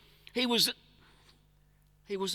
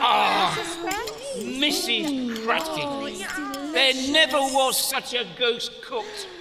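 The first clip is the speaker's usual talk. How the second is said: hearty excited praise; 6 semitones higher than usual. Acted. Father praises the goose.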